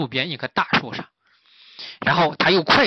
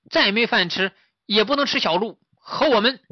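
high-pitched piglet objection; protesting piglet; 8 semitones higher, 4 dB louder than usual. Acted. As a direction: firmly object in a little pig's voice. 小猪反驳大师. (小猪高声反对)

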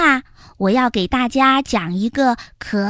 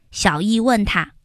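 plain reading voice

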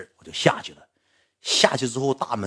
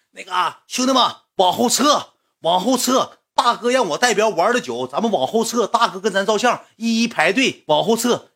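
high, raised calling-out voice; officious crowd organizer; 10 semitones higher, 4 dB louder than usual. Retold or imitated. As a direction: imitate a self-important helper herding a crowd. Eager fan herding crowd, acted.

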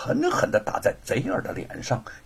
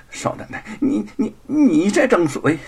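stammering outrage, rising higher and louder; indignant and embarrassed; 8 semitones higher, 6 dB louder than usual. Acted. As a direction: sputter at the young mistress, flustered. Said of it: "又是气愤又是尴尬". (又气又窘、结结巴巴)

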